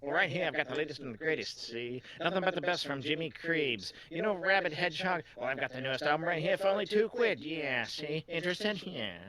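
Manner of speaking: put-on old-time gangster salesman patter; wheeling-dealing movie tough guy; hard-sell it like an old gangster picture; Jimmy Cagney. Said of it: "I'm gonna make him, uh, Jimmy Cagney".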